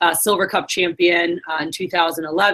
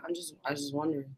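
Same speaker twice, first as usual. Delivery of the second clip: sheepish mumble; 4 semitones lower, 17 dB quieter, faster than usual. Retold or imitated. Sheepish backpedal after being scolded.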